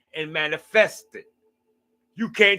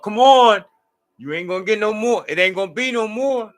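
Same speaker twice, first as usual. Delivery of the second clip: loud, high mock mom hollering, then quicker; mom's voice; 6 semitones higher, 7 dB louder than usual. Mom waking her son for Saturday breakfast, high loud mock holler. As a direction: holler up the stairs like an impatient mother. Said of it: "that's her voice".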